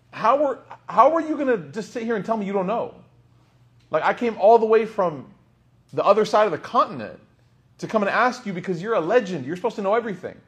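high, exasperated complaint from the frustrated traveller; frustrated visitor. Retold or imitated. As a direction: pitch it up and vent his frustration. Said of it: "the guy became upset, became frustrated".

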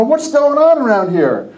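high-pitched retold puzzlement; baffled question. Newcomer to the hallway commotion, baffled. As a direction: ask it as if startled by a crowd.

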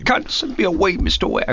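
weak attempt at Michael Caine; Michael Caine impression. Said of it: "We have to work on that".

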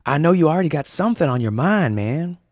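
high, loud, fast surfer dude; fast high eager dude; Julian's dude voice. Acted. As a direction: push him to confess, quickly. Julian presses Winston.

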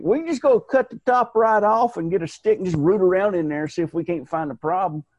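put-on hillbilly surgeon in a Southern accent. Mocking a brain surgeon with a Southern accent. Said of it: "brain surgeon with a Southern accent".

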